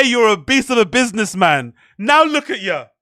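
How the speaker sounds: usual speaking voice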